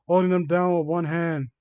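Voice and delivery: ordinary delivery, nothing marked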